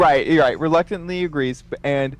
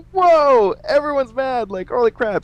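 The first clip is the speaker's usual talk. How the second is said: shocked; 11 semitones higher than usual. Retold or imitated. His shocked reaction retold.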